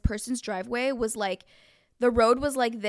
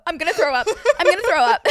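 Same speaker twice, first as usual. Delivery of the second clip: high, loud, laughing mock panic; laughing imitation; 7 semitones higher, 10 dB louder, faster than usual. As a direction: laugh through her friend's words. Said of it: "She's like".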